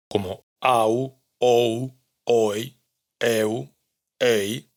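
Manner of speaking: diptongos au, ou, oi, eu, ei pronunciados claramente y despacio; diptongos islandeses. Shown as a demonstration. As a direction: demuestra cada diptongo aislado. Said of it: "combinación de dos sonidos vocálicos". (diptongos islandeses demostrados)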